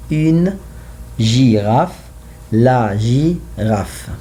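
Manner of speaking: la girafe and une girafe very slowly; slow drill. Shown as a demonstration. Teacher drills la girafe and une girafe slowly. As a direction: drill each word slowly for the class. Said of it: "when I say the".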